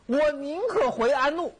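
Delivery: high-pitched, indignant retort; acted worked-up emperor. Young emperor answers his chief minister, worked up. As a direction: play a young ruler all worked up. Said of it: "也，也兴奋了".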